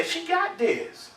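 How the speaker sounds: high, soft, drawn-out aside; slow, unbothered comic voice; chilled-out lion. Acted. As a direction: play a lion lazily leaving it to her. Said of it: "the lion chill and say".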